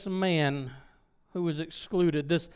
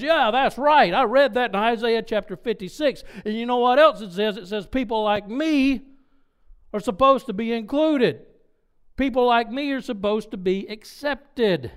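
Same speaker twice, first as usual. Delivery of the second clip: raised, louder voice of eager recognition; excited agreement; 3 semitones higher, 4 dB louder than usual. Retold or imitated. Simeon answers Philip about being included, in excited agreement. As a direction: act out an excited man claiming his place.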